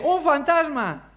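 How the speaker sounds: mock terrified cry, pitched high and slow; frightened cry. Disciples on the boat seeing a ghost, frightened cry. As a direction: cry out as if you've seen a ghost. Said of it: "en medio del temor dicen".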